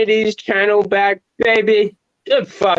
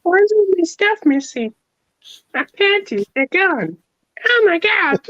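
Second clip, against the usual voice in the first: shrill panic, much higher; panicked high voice; 14 semitones higher than usual. Pretend. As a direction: freak out in a squeaky voice. Acting the neighbour finding stuff gone.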